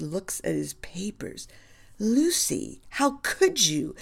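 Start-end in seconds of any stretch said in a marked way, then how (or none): 2.0-3.9 dismayed outburst. Acted. Cup spills on his dispatches.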